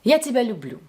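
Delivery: "Я тебя люблю" with the voice falling on "люблю"; calm everyday "Я тебя люблю", no word singled out; calm, natural. Shown as a demonstration. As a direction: neutral statement, let "люблю" drop. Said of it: "This sounds calm and natural".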